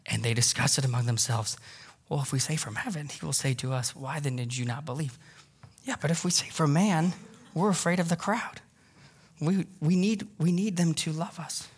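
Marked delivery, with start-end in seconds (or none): 2.1-5.2 hushed scheming. Retold or imitated. Leaders whisper among themselves. 5.8-11.7 hushed worried. Retold or imitated. Leaders fret about the crowd.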